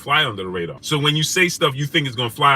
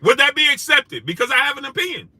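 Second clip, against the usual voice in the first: much higher, pushing each question harder; heated rhetorical rant; 10 semitones higher than usual. Real, not acted. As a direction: demand an answer from the chat.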